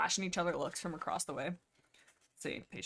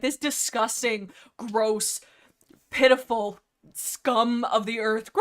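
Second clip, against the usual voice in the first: mock disgust; 7 dB louder, slower than usual. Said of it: "They're like".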